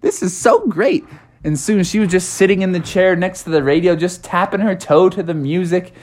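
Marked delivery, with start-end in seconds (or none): 0.0-1.0 high, swooping delight; high-voiced, bubbly enthusiasm; gushing Froggy's mom. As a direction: gush over your new favorite song. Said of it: "Froggy's mom was like".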